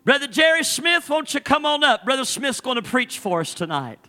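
high, loud, driving preacher cadence; preaching at full force. Acted. Pastor retelling his mother-in-law's story to the congregation, full-force preaching.

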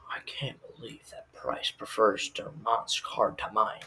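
slow, hushed mock-Bateman whisper; whispered inner monologue. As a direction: whisper it slowly, as a secret thought. Bateman's inner seething, retold.

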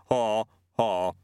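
lightly voiced yeti saying a laugh; spoken laugh. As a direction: say the laugh as words, without laughing. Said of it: "it said it rather than laughed".

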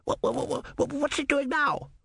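whispered repeats jumping into a high squeak; a spectator who can't contain himself; breathless, high eager babble; excited stammer. Acted.

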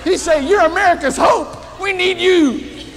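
rally him with conviction; fervent shout. Retold or imitated. Steve emphatically encouraging Henry.

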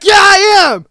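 imagined comeback to the haters, shouted; defiant shout. Pretend. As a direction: yell it right back at the critics, owning it. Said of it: "I'm probably gonna be like".

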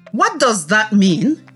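normal talking voice

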